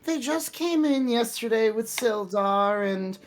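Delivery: mocking high squeaky voice, rushing; sheepish excuse. Clerk mimicking Linian's nervous excuse in a high squeaky voice. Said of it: "It's like".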